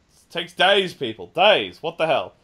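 loud exasperated rant at the audience. Real, not acted. Host venting to listeners, loud and exasperated. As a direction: throw up your hands and vent loudly. Ranting about instant bank transfers.

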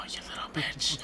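hushed, breathy whisper, half-laughing; teasing whisper. Pretend. Teasing a friend about game emotes, in a whisper. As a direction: whisper it to tease your friend.

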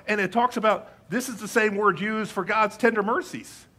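ordinary delivery

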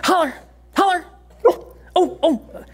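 high, excited calls to get the dog howling; laughing, high-pitched urging of the dog; high coaxing. Real, not acted.

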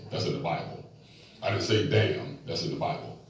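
low, softened confessional aside; sheepish confession. Real, not acted. Preacher admitting his cursing to church, sheepish and hushed. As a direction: drop low and own up sheepishly.

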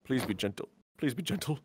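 very soft, low aside; timid plea. Acted. Hero pleading before the fight, hushed low plea. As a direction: plead under your breath.

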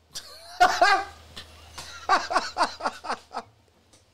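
loud high cackle; high laugh. Real, not acted. Laughs at enemy found.

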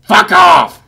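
angry shout. Acted. Yelling at a hissing robot.

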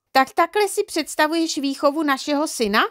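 high-pitched outraged mother; indignant exclamation. A narrator's reading. Mother storms in on father about son's upbringing, indignant.

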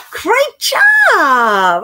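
put-on high, loud cheer for the kids; excited praise. Librarian cheers the children after singing, loud and excited. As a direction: cheer them on loudly.

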